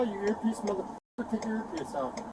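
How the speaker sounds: soft, low-pitched insult; quiet, low hostile jab; low taunt. Real, not acted. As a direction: needle the officer under your breath. Detainee needling officer in low voice.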